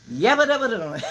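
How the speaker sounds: loud laughing yabba dabba shout; mock cussing burst. Friend Peaches cussing out a pushy man, shouted laughing gibberish. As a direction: blurt the stand-in cussing with a laugh. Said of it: "she get the".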